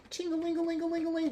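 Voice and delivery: high, quick sing-song jingle of falling coins; tinkling coin noise. Retold or imitated. Reader mimicking abundance falling from the sky, jingling. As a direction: chime it like money raining down. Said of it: "that's the abundance falling out of the sky".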